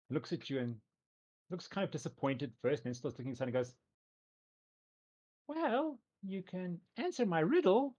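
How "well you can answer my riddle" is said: put-on high, slow teasing teen; teenage girl voice. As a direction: draw it out like a bratty teen. Nixie offers riddle.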